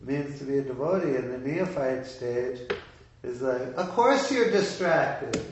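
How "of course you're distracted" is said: "of course you're distracted" pitched high, loud and drawn out; emphatic obviousness. Voicing the master's answer to a distracted devotee, emphatic "of course you're distracted". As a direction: stretch "of course you're distracted" slowly in a raised, louder voice.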